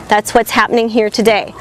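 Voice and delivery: ordinary talk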